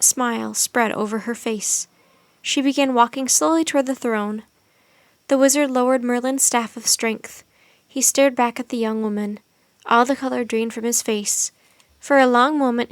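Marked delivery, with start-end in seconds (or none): none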